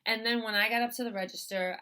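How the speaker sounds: ordinary talk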